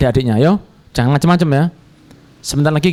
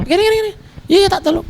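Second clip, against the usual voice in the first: high, mocking fake promise; mocking brother's fake help; 13 semitones higher, faster than usual. Sam'un sneering at Yusuf in the well, high mock. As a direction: sneer it like a false friend. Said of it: "Sam'un itu bilang".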